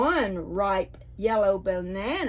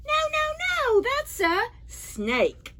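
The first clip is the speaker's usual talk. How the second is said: put-on much higher voice, a child monkey saying no; little monkey protesting; slower than usual. Storyteller to children, as the monkey, high protest.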